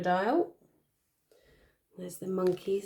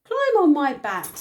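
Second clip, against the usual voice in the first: high, louder squeaky giraffe; high squeak; 9 semitones higher, 9 dB louder than usual. Acted. Giraffe offering his back to the animals, high squeak. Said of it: "he squeaked in a high voice".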